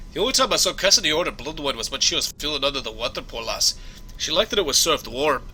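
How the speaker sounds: conversational Scottish brogue; Scottish accent. Acted. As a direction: offer help as a Scottish engineer. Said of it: "my Scottish accent needs some work".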